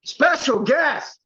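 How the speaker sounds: high, drawn-out showman's introduction; mock announcer. Bandmate introducing a guest to the host, hammed-up announcer. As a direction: announce a star guest, stretching the words.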